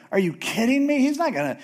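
high, disbelieving whine; incredulous protest. Retold or imitated. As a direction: protest like a whiny son. Jacob objects to his mom.

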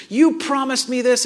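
demanding accusation. Acted. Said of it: "It's not demanding. It's not harsh".

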